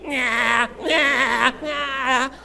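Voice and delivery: high-pitched imitation bleating; goat bleat. Goat carried down Main Street, high imitated bleats.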